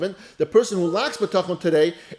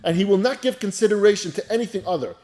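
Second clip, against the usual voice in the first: ordinary delivery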